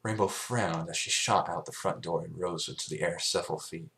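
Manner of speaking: ordinary delivery, nothing marked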